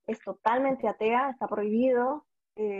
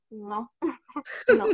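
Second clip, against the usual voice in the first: laughing; slower than usual. Retold or imitated. Quoting herself, laughing.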